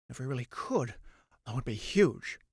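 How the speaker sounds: low, soft wondering aside; hushed wonder. Acted. Someone musing on making rain, low soft aside. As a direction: muse quietly about it.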